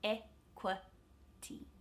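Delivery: EQUITY very slowly, e, kwuh, tee; three spaced syllables in EQUITY, weak middle; schwa middle syllable. Shown as a demonstration. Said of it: "that middle I is a schwa".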